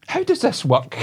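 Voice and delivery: high-pitched puzzled old-lady impression; mock old lady, pitched right up; wee old woman. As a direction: imitate an old woman asking for help. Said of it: "this wee old woman".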